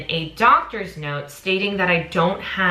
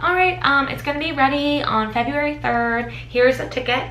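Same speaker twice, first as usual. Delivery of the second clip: higher, brisk official voice; consulate officer; 8 semitones higher than usual. Retold or imitated. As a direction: hand over the ticket like a clerk. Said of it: "She was like".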